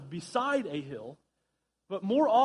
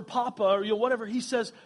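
usual speaking voice

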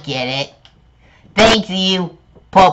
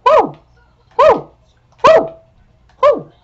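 high, loud cheering whoops; excited whoops; 5 dB louder, slower than usual. Real, not acted.

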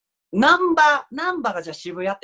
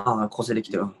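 ordinary delivery

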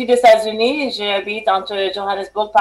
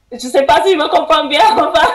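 giggling self-consciously; laughing; 8 semitones higher, faster than usual. Real, not acted. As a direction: laugh it off, embarrassed. Laughs at own confusion.

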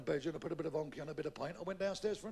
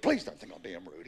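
low-pitched, restrained plea; lowered-voice pleading; 9 semitones lower than usual. Acted. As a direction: repeat the plea with the voice dropped right down. Same plea, brought down.

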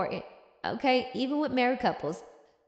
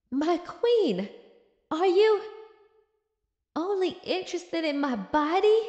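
higher, hammed-up wounded question; joking Henry; 5 semitones higher than usual. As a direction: play mock offence with a big swoop in pitch. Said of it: "But Henry joked".

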